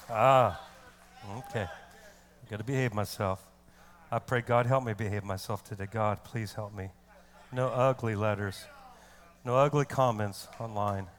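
very low, soft aside; subdued self-restraint. Real, not acted. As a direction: rein yourself in, praying quietly under the breath. Reins self in after preaching peak.

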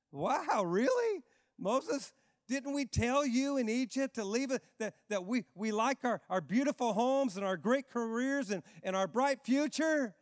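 high, mocking whine; sarcastic grumbling. Israelites complaining to Moses, high mocking sarcasm. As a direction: throw it back at Moses with scorn. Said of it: "you gotta see the sarcasm".

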